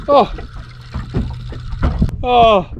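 ordinary delivery

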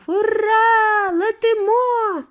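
high, loud joyful yell; excited shout. A narrator's reading. Grandpa whooping as the elevator drops, loud and high. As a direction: whoop like a kid on a ride. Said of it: "а дідунь Джо закричав".

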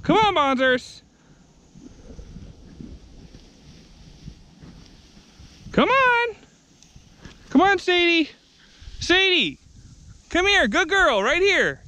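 high, bright sing-song call to the dogs. Real, not acted. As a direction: coax the dogs over in a high voice. Calling the dogs to come.

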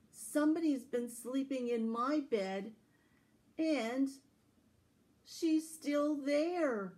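alarmed tiny bear drawing out the discovery; Baby Bear telling the others the girl is still there, high and slow. Acted. Baby Bear finds Goldilocks.

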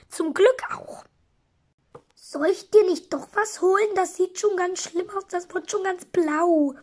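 ordinary talk

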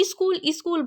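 iskul with an added i before the s; mispronounced iskul. Shown as a demonstration. Teacher showing how many people say school, as iskul. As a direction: put an extra i at the front of school. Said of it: "বলে অনেকে".

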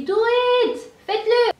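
high, loud yell of do it; shouting. Retold or imitated. Mother in labour yelling at the anesthetist, loud shout. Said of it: "et moi je criais".

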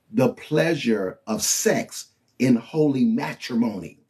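ordinary talk, nothing marked

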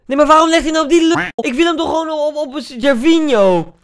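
high, loud exasperated complaint; frustrated shout. Real, not acted. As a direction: yell at the pass. AI passes wrongly, frustrated.

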